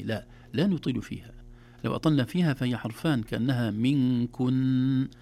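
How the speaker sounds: normal talking voice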